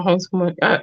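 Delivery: normal talking voice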